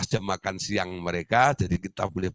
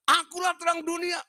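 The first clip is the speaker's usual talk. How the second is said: very high shouted proclamation; patient's answer. Retold or imitated. The patient tells the doctor why he stays up, high shout. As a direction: declare it like a madman.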